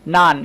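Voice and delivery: নান said with an open a sound; model word. Shown as a demonstration. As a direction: sound নান as naan, not non. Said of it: "ও লেটারটা আমরা আ এর মতো উচ্চারণ করছি".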